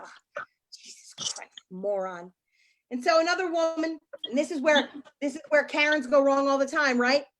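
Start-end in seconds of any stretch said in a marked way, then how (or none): 0.0-2.3 hushed, unvoiced exasperation; exasperated whisper. Wife muttering at Joey under her breath, exasperated.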